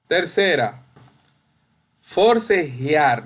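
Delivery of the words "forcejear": "forcejear" slow, ending in one stressed glide syllable, for-ce-JIAR; teacher's slow model of "forcejear", last syllable JIAR; correct pronunciation. Shown as a demonstration. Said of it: "No decimos forcejear".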